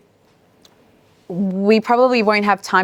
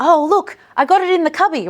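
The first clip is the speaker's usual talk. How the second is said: playful excitement; 8 semitones higher than usual. Retold or imitated. Turning throwing into a game.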